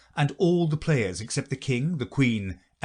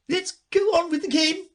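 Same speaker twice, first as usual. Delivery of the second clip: bossy queen; 4 dB louder than usual. A narrator's reading. Queen voiced in story.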